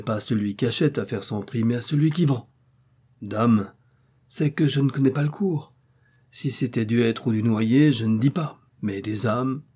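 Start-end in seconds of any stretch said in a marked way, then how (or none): none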